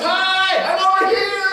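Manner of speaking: high, loud waving yell; yelling hello. Retold or imitated. Greeter yelling to arriving passenger, loud high yell. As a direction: shout across the arrival hall. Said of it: "they start yelling".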